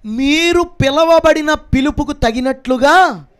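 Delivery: slow, drawn-out declamation on a high pitch; scripture proclamation. Acted. Preacher reads the calling verse to his church, declaiming. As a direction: proclaim the verse, building to a ringing close.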